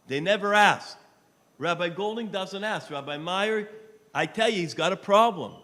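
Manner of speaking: loud, high pleading fundraiser voice; loud charity appeal; impassioned appeal. Real, not acted. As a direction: preach it with rising fervor. Fundraising appeal at full force.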